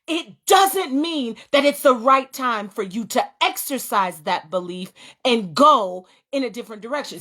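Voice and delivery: ordinary delivery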